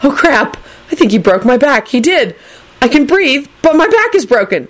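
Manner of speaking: high, panicked character voice; mild panic. A narrator's reading. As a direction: whine in rising panic. Neville panics about his back.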